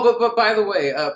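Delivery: ordinary delivery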